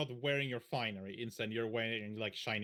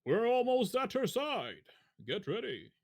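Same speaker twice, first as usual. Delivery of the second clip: disguised deep voice; 6 semitones higher than usual. Acted. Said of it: "his deep voice calls out".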